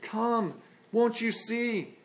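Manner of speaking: soft, slow pleading with tears; gentle tearful plea. Acted. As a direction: implore gently, a little slow. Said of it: "tears in their eyes ... implore and plead".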